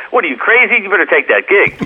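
high, incredulous mock scolding; imitating his friend. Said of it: "He goes".

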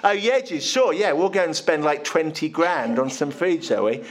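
drip with sarcasm at the silly idea; sarcastic. Acted. Disciples mocking Jesus's request with sarcastic disbelief.

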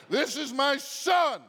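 "This is my son" shouted high and slow; booming voice from heaven. Shown as a demonstration. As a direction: proclaim "This is my son" like God from heaven. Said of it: "that's how the voice from heaven sounds".

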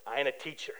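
quiet, low threat; retold standoff line said under his breath; low warning. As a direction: drop it low and menacing. Squaring off with student at door.

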